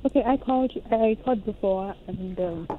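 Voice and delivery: speaking very softly, barely audible; almost too quiet to hear on the phone; low talker. Real, not acted. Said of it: "you're a low talker".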